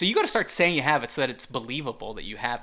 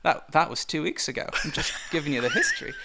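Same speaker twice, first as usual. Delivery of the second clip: normal talking voice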